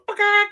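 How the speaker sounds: high loud imitated chicken cluck; sudden mock chicken squawk. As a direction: squawk it like a laying hen. Chicken sound in timer idea.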